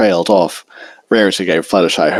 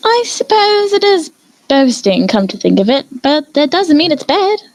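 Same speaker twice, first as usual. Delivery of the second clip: higher, refined lady's teasing voice; Rarity defending Fluttershy and teasing Twilight, refined and higher; genteel Rarity. A narrator's reading. Rarity musing.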